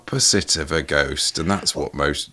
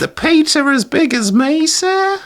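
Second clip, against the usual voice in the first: put-on very high, louder piping little-boy voice; small child character; 4 dB louder than usual. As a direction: pipe up as a pitiful Dickensian urchin. Mock Tiny Tim-style child character.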